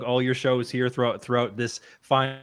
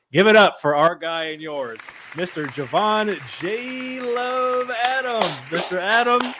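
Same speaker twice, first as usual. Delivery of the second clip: announcer voice; 5 semitones higher than usual. Acted. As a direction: bring the guest on like a show host. Announcing guest to stream.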